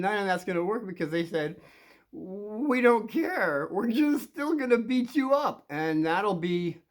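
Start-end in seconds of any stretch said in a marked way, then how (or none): 2.1-5.6 drawn-out, higher-pitched mocking threat; taunting bullies. Bullies tell the kid they'll still fight, taunting. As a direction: stretch it out like a bully brushing it off.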